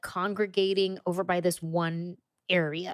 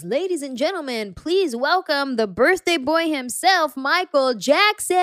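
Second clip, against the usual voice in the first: DJ announcer; 5 semitones higher, 3 dB louder than usual. Retold or imitated. DJ welcomes the birthday boy at a party, big announcer voice. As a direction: announce the star, stretching out the name. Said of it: "the D J goes".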